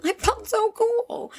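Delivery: high, drawn-out mock gushing; hammed-up gushing. Telling viewers about her teenage outfit, hammed-up gushing. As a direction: stretch it out high and slow, playing up the boast.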